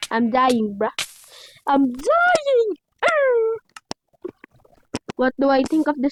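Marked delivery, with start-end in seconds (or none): 1.7-3.6 frustrated cry. Real, not acted. Dies in game, cries out.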